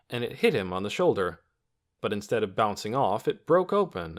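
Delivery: ordinary delivery, nothing marked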